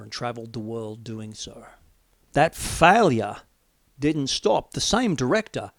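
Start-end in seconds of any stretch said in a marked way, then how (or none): none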